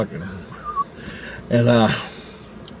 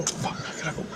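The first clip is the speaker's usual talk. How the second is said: whispered note to self; hushed urgency; 4 semitones higher, 15 dB quieter than usual. Retold or imitated. Dawn is leaving soon, hushed urgency. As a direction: mutter it under your breath, urgently. Urgent private thought before she leaves.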